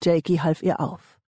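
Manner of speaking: plain reading voice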